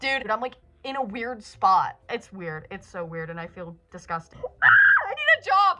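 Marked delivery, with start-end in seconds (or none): 4.4-5.8 high, loud panicked outburst; freaking out. Real, not acted. Venting to the camera about stress, freaking out. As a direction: cry out in a sudden stressed-out panic. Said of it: "I'm literally freaking out".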